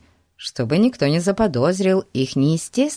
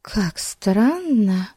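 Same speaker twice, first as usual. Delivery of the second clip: Betsy's thoughts; 5 semitones higher, much slower than usual. A narrator's reading. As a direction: think it slowly to herself. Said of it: "подумала Бетси".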